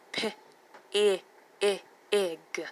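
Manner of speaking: "P, I, g" sound by sound, I as short ih. Shown as a demonstration. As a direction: break pig into "P", repeated short "I", then "g". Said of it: "is the short I".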